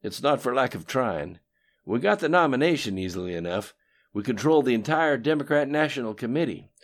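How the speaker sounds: lightly voiced cocky politician; Bill's voice. A narrator's reading. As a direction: brag about old schemes, loud. Bill rants about election.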